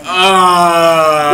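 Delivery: loud, high sustained "aah"; drawn-out regretful wail; long pained yell. Retold or imitated. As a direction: cry out in mock agony. Acted reaction after downing the food.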